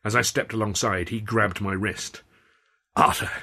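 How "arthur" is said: urgent, unvoiced hiss; forceful stage whisper. A narrator's reading. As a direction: hiss the name to get his attention. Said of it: "he hissed".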